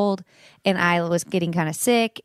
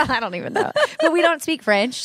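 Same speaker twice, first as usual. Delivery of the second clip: giggling through the words, higher; laughing; 7 semitones higher than usual. Real, not acted. Laughing to host about not speaking French. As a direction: laugh out the confession.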